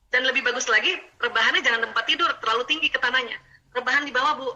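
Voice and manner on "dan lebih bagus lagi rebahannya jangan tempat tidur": ordinary talk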